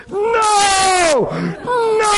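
high, drawn-out cry of no; hammed-up high wail of protest; frantic inner protest. Preacher's mind protesting during the phone call, frantic protest. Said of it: "my mind's going".

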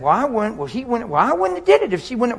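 high-pitched mock squabble; mock bickering. Preacher mimicking a couple in a little dispute, high bickering. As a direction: act out a petty defensive back-and-forth.

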